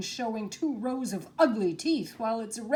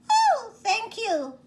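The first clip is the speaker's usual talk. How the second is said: very high, squeaky tiny voice, slowed down; squeaky little mouse; 13 semitones higher, 4 dB louder than usual. Acted. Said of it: "it said in a squeaky little voice".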